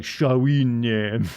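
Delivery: drawn-out mock French moan. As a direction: moan "Shawine" in exaggerated French. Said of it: "French is a very moaning language".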